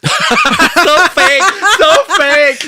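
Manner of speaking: high laughing tease; giggling and calling it fake. Real, not acted. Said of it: "teasing laugh".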